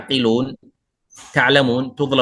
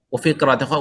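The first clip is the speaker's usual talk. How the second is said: ordinary talk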